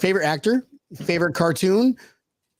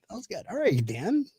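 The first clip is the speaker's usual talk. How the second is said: ordinary talk, nothing marked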